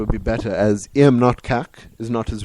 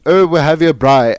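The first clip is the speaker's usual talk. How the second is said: loud, higher, mock host; mock host announcement; 4 semitones higher, 8 dB louder than usual. Co-host mimics what not to tell guests, mock host announcement. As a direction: put on an excited host announcing. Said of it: "don't tell them".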